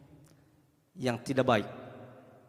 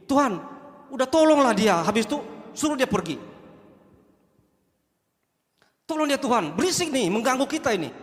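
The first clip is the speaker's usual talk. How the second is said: annoyed disciples; 6 semitones higher than usual. Acted.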